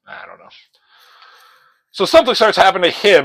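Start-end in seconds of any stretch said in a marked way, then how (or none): 0.1-0.5 soft coy whisper; playful whispered shrug. Real, not acted. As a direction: whisper it like a tease. Mock coy aside.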